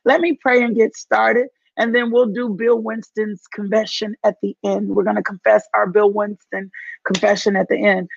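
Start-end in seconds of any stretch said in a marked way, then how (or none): none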